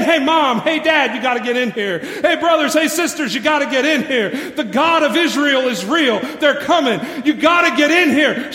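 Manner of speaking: loud, urgent calls to her family; Rahab pleading with family. Acted. Rahab calling her family into the house, urgent loud pleading. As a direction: beg them to come inside.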